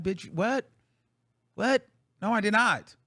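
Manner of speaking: high, loud outraged what; outraged denial, pitched high and loud; indignant disbelief. Retold or imitated. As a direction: shoot back in shocked disbelief. Indignant retort to haters.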